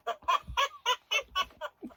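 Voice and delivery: high giggle at her own joke; high laugh. Real, not acted. Woman laughs at the pun she just told. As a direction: burst into a giggle.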